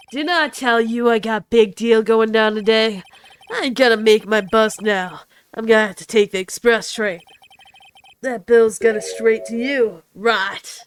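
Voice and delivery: put-on Brooklyn accent slipping southern. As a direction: tough Brooklyn gangster accent. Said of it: "My freaking Brooklyn accent keeps going southern".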